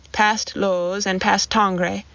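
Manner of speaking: usual speaking voice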